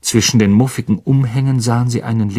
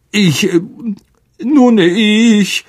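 raised, halting stammer; stammering Lockhart; 10 semitones higher, 4 dB louder, much slower than usual. A narrator's reading. Lockhart cornered by the teachers, stammering. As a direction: stumble over the words, caught out. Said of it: "stammelte Lockhart".

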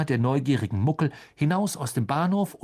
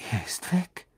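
put-on boy realising the train has gone, whispering; stunned. A narrator's reading. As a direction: breathe it out in disbelief. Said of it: "sagte Ron mit verblüffter Stimme".

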